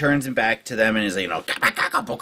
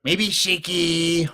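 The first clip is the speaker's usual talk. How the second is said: slow, pidgin goblin voice, often pitched up; Shaky, scheming goblin; 3 semitones higher, much slower than usual. Acted. Goblin advising allies on attack plans, slow and deliberate. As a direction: think it through slowly in broken speech. Game master speaks as Shaky.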